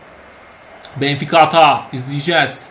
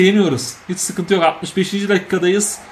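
ordinary talk

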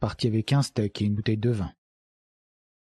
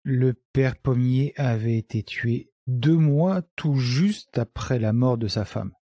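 usual speaking voice

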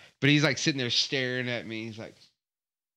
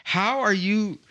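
incredulous; 6 semitones higher than usual. Retold or imitated. Reacting to salt on package.

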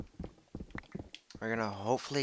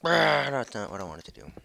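loud, higher annoyed complaint; frustrated outburst; 5 semitones higher, 11 dB louder than usual. Real, not acted. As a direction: blurt it out in frustration. Mining mistake, frustrated outburst.

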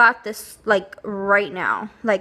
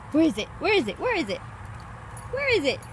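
rising higher with each repeat; building excited pet talk; 7 semitones higher, 3 dB quieter, much faster than usual. Real, not acted. Owner cheering her dog on to find the treat, higher each time. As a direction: egg the dog on, more and more eager.

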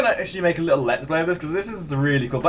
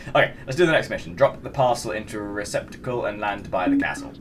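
usual speaking voice

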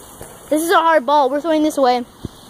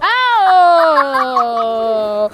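pained ow; 5 dB louder than usual. Real, not acted. Hit by a ball.

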